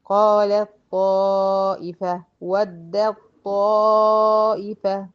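very slow recited قالت طائفة and ودت طائفة, ت and د merged into the heavy ط; slow tajweed recitation; Quranic recitation. Shown as a demonstration. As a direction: chant slowly and resonantly. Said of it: "بدخل من الدال على الطا".

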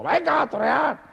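very high, drawn-out command; hammed-up high-pitched official; ordering mayor. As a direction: squeal the order in a put-on voice. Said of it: "el alcalde me hacía con el dedo".

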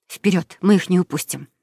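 usual speaking voice

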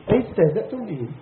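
ordinary delivery

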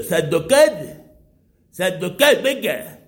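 loud congested call to a dog; odd, stuffed-up voice, calling out; calling with a cold. Pretend. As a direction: call your dog through a pretend head cold. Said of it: "Entonces yo llamo al perro".

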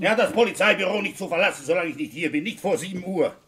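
high, fast, exasperated rebuke; indignant scolding. Acted. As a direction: vent at the absent gendarme. Scolding the absent gendarme.

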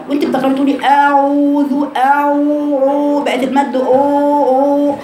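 أعوذ with the و dragged very slow and darkened; mock the heavy drawn-out و; wrong heavy waw. Shown as a demonstration. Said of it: "ترتب عليها تفخيم الواو".

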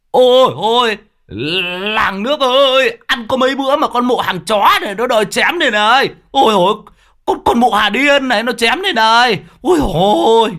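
loud, high panicked wailing; wailing cry for help. Acted. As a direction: howl for the whole village. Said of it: "vang lại tiếng".

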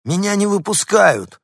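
little dog complaining from the balcony. A narrator's reading. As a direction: whine about being locked in. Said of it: "пожаловался Дуля".

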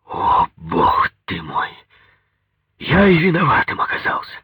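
groan, then protest at being blamed; incredulous, aggrieved outburst; exasperated. A narrator's reading.

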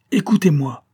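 loud, high furious roar; deafening shout. A narrator's reading. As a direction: blast it at her like thunder. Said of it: "cria-t-il d'une voix étourdissante". (cri furieux et assourdissant)

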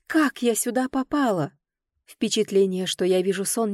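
ordinary delivery

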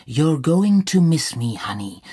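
mildly wild, shameless call; narrator's restrained belting; wild cry. A narrator's reading. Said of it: "Sie schrie wild und ohne Scham".

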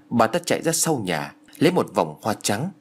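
ordinary delivery, nothing marked

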